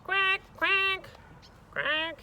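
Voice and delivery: high, drawn-out loud quacks; big squawky duck calls; duck quacking. Acted. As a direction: honk it out like an insistent duck. Duck imitation in story.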